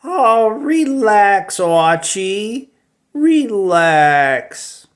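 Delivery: easygoing pal telling Archie to calm down; Jughead's voice. Retold or imitated. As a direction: stretch out "relax" lazily. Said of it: "here's me giving a very familiar Jughead line".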